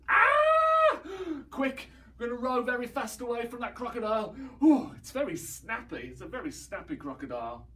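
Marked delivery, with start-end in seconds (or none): 0.1-1.0 shrill gasp and high mock scream; playful fake crocodile scream. Pretend crocodile fright. 1.5-6.1 mock panic. Fleeing pretend crocodile.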